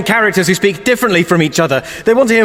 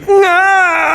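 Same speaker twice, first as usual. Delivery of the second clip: anguished cry; 9 semitones higher than usual. Acted. Losing his mind to sass, mock wail. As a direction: howl in comic despair.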